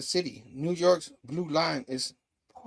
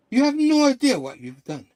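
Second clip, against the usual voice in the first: raised, higher-pitched accusation; heated confrontation; 8 semitones higher, 7 dB louder than usual. Retold or imitated. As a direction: throw it loudly at your enemy. Hero confronts jailed villain.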